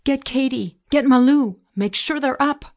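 high, urgent shouted commands; screamed orders. A narrator's reading. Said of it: "I screamed".

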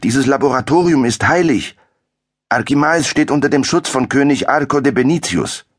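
high, heated protest; indignant boy. A narrator's reading. Young Arturo shields his master from the soldiers, indignant. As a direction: defend the master's lab fiercely. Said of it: "schrie er aufgebracht".

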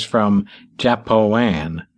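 "Japoan" drawn out very slowly; slow countrified "Japoan"; odd pronunciation. A narrator's reading. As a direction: stretch "Japoan" like a yokel. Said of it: "testing the sounds".

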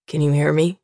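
a hint of a worried father; father's voice. A narrator's reading. Father speaks to unconscious daughter.

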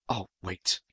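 low, hushed aside; sudden realization. A narrator's reading. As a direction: drop low and soft as it dawns on you. Paul suddenly remembers, breaks off.

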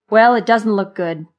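loud, gloomy complaint; pessimistic. A narrator's reading. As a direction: grumble out the bad news firmly. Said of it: "began with his usual pessimistic outlook".

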